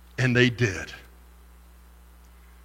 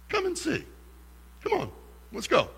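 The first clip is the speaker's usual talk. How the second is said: soft, much higher and much quieter, tender; gentle loving invitation; 11 semitones higher, 13 dB quieter than usual. Retold or imitated. Preacher voices Jesus inviting the disciples, gentle and soft. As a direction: invite them gently, almost lightly. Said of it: "that gentle, loving way".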